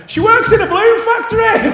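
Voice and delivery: very high, full-volume mock shout; group yell. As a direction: yell it like a whole hen party. Whole group yells the punchline.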